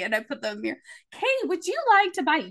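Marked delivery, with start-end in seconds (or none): 1.1-2.5 higher, chirpy saleswoman; mock sales pitch. Saleswoman pitches to her own mirror image, chirpy and high.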